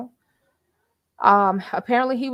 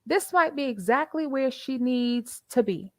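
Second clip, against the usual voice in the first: calming friend; 5 semitones higher than usual. Retold or imitated. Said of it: "But they're like".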